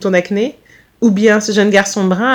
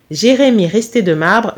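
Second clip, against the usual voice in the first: usual speaking voice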